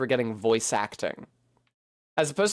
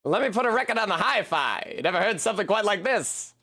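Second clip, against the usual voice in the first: a light mid-Atlantic dad, pitched higher, showing off his record; old-timey transatlantic father, proud of his jazz; 6 semitones higher than usual. Pretend. Dad putting a jazz record on for his daughter, in a light transatlantic accent. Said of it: "Minty's dad has a transatlantic accent".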